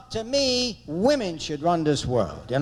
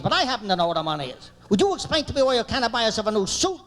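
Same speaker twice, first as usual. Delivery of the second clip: screaming wife; 2 semitones higher than usual. Retold or imitated. Wife berating husband over his old suit, screaming. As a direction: imitate a fed-up wife laying into him. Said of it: "She's screaming".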